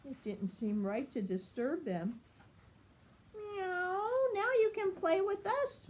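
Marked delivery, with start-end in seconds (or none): 3.3-5.9 high cat voice with a meow; meow, then talk like a cat. A narrator's reading. Henry the cat invites Pup and the mice, high and meowing. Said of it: "meowed Henry".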